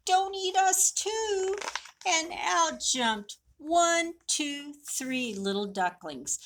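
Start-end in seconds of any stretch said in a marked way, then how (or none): 0.1-1.6 high, squeaky frightened plea; terrified plea. A narrator's reading. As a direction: beg not to be eaten. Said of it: "They said".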